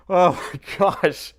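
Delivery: loud, drawn-out relieved cry; relieved exclaim. Real, not acted. Streamer bursting out as the task finishes. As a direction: let the tension burst out. Relief after tension breaks.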